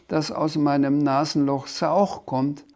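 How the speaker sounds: „Sauch“ mit S am Anfang statt R. A narrator's reading. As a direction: bei „Sauch“ das R durch ein S ersetzen. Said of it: "Du kannst kein R". (Sprachfehler: „Sauch“ mit zischendem S)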